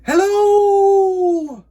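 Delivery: big high, loud shout across the mountains; shouted hello. Acted. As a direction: bellow it from the mountaintop. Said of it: "and again he shouted".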